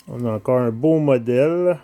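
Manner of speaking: ordinary talk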